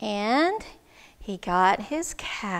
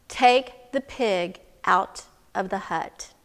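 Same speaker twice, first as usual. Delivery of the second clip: slow, low, deliberate advice; measured instruction; 5 semitones lower, slower than usual. Acted. Wise woman instructing the man, slow and deliberate. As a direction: space it out calmly, phrase by phrase.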